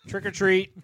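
a touch of a grumpy trick-or-treater; impression of Greg. Friend imitating Greg at a door, mock kid voice. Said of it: "Here's my impression of Greg".

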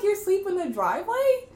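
high, loud questioning disbelief; mock incredulity. Grandchild to grandma sleeping in driveway, loudly incredulous. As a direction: question it in comic amazement.

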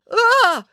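high, loud yelp; startled cry. A narrator's reading. Dad getting milkshake in his lap, startled cry. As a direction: cry out in shock. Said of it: "cried Dad".

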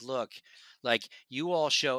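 usual speaking voice

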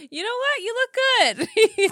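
high, loud compliment through laughter; amused, pitched well up; laughing. Real, not acted. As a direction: crack up while saying it. Cracking up at co-host's intro.

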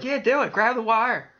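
high-pitched, eager goading; mock egging on. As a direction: imitate someone daring a friend on. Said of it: "I just couldn't egg that on".

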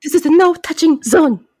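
fussy villain with a put-on accent; Kamun Ra. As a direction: lay down the rule in a hammed-up accent. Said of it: "please forgive my horrible accent".

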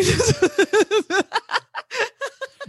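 long high-pitched laugh; high laughter. Real, not acted. Friend cracking up at own bestiality joke, high laughter. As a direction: burst into high, helpless laughter.

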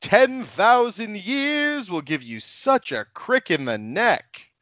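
high, slow, theatrical curse; campy villain line; hammed-up movie villain. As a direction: drag out the threat like a sorcerer. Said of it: "I'm gonna go with".

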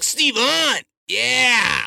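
high, crowing dude voice; put-on bully's triumphant cheer; eighties bully. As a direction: cheer the name like a cocky bully. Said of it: "some guy that's an eighties bully".

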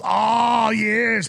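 a clumsy try at the villain's voice; attempted Schwab voice. Shown as a demonstration. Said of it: "I can do kind of an".

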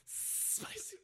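breathy high laugh on the word. Real, not acted. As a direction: giggle the word out. Echoing through laughter.

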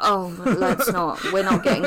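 laughing while protesting. Real, not acted. Laughing off rubber joke.

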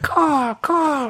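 very high, stylised bird caw; regular crow caw. Retold or imitated. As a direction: mimic a textbook crow call. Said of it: "It wasn't like a regular".

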